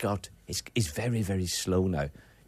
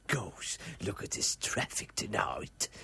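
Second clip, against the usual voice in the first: mock impression; 4 semitones lower, 8 dB quieter, slower than usual. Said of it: "I was saying".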